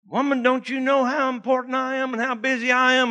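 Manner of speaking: mock the impatient shopper you were; self-important huff. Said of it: "I shouldn't have said woman".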